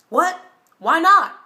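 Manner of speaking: high, loud, startled protest; indignant disbelief. Acted. Date told she changed her mind about the motel, indignant. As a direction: burst out as if suddenly refused. Date reacts to being turned down.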